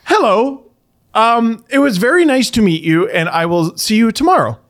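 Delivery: put-on high, prim child voice; polite little girl imitation; matter-of-fact little girl. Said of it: "Like, just very matter of fact".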